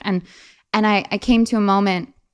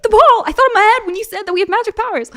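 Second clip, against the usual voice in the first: much higher, fast and insistent; excited kid; 14 semitones higher, 6 dB louder, faster than usual. Retold or imitated. Daughter explaining to her mom, higher and fast. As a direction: rush it like an eager kid explaining. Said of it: "I was like".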